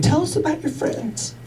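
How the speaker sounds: a faint prodding off-stage voice; imaginary interviewer, barely changed. Pretend. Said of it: "Yes, imaginary voice".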